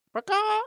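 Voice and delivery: high, loud caw; a sharp raven's call; raven croak. Acted. Old raven ends greeting with a loud caw. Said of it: "he croaked".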